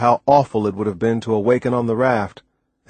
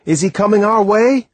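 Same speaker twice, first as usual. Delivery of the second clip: raised, louder eager question; urgent hope; 11 semitones higher, 6 dB louder than usual. A narrator's reading. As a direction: ask urgently, pitched high. Hopeful question as plane approaches.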